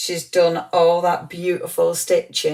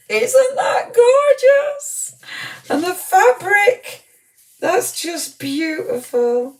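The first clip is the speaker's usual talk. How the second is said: much higher, slow, squealing with delight over a gift; 14 semitones higher, 4 dB louder, slower than usual. Real, not acted. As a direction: coo over it like a treasure. Gushing over a handmade gift.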